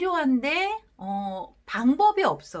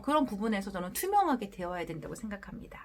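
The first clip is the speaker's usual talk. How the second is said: ordinary delivery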